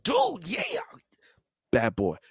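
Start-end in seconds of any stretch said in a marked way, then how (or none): 0.0-0.8 excited reaction. Retold or imitated. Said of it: "I was like".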